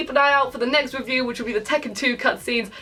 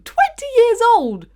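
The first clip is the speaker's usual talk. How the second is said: much higher and louder, in disbelief; shocked exclaim; 9 semitones higher, 5 dB louder than usual. Real, not acted. Vlogger stunned by a character's age, shocked exclaim. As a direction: blurt it out, astonished.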